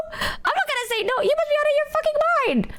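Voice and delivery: very high, fast squealing rant; excited outburst. Real, not acted. Telling chat she would never refuse, high fast squeal. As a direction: blurt it out, rushing and way up high.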